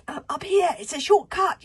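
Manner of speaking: high, loud call to follow; yelling. Acted. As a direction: shout the way out to friends. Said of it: "yelled Kamikaze".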